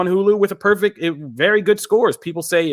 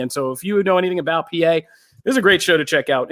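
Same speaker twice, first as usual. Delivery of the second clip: normal talking voice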